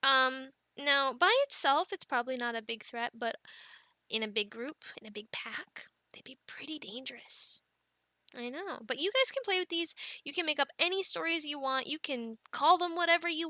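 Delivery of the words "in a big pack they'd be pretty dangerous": put-on hushed, menacing hint for the kids; spooky whisper. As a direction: whisper it like a scary secret. Scary dinosaur talk to kids.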